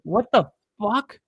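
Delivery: shocked outburst. Real, not acted. Fan shocked by play.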